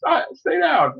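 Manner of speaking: mock telling a friend to sit back down; mock hushing. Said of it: "they're like".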